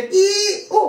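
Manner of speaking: "I, U, U" held long and high; vowel demonstration. Shown as a demonstration. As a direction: stretch each of "I", "U", "U" separately on a high note. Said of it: "ce sont des voyelles faibles".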